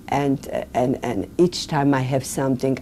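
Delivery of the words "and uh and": normal talking voice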